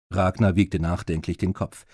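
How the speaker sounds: plain reading voice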